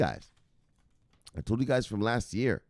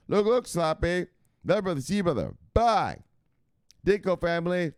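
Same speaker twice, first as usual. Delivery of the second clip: hammed-up sign-off; 8 semitones higher than usual. Catchphrase sign-off.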